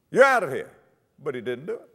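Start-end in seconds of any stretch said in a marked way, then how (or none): 0.1-0.7 loud, higher-pitched dismissal; acted order, punched out loud; forceful command. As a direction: throw him out with a raised voice. Acted command to the serpent, repeated.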